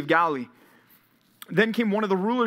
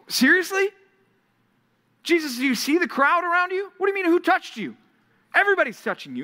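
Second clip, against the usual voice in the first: high, loud disbelieving protest; incredulous disciples; 13 semitones higher, 3 dB louder than usual. Acted. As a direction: scoff at the question in disbelief.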